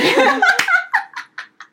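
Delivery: loud, high-pitched laughing fit; burst of laughter. Real, not acted. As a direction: crack up helplessly. Laughing at own story. (高声大笑)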